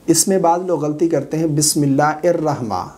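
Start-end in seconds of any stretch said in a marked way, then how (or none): 1.5-2.9 الرحمن begun on a glottal "er", dropping the h before it; common mispronunciation. Shown as a demonstration. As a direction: say "bismillah er-rahma" for بسم اللہ الرحمن, without the "hir". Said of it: "یعنی ہا کو ہمزہ پڑھتے ہیں".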